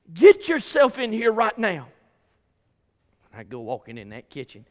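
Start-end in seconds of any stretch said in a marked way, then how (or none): 0.1-1.9 loud, commanding mother; stern order. Retold or imitated. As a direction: snap him into the kitchen. Mama orders him in.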